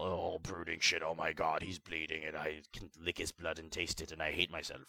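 low, soft mopey emo voice; a hammed-up angst-ridden hero, low and murmured; mock brooding emo. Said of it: "That's all".

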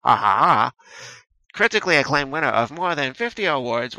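usual speaking voice

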